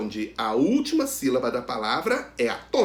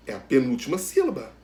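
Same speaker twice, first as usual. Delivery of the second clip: normal talking voice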